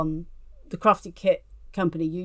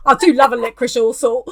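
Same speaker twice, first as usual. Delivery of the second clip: laughing; 4 semitones higher, 7 dB louder than usual. Real, not acted. Confessing love of licorice.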